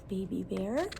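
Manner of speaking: ordinary delivery, nothing marked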